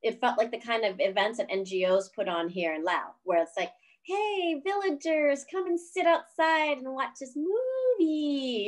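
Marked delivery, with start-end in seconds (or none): 4.0-8.6 high, slow hailing call to a crowd; mock announcer calling villagers. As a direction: beckon the whole village over, drawn out.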